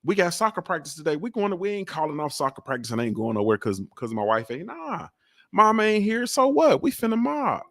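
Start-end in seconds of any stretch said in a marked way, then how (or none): 4.7-7.6 much higher, animated voice; defiant. Acted. Dad insists outings go on without mama, defiant. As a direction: push it up high, brushing off the objection.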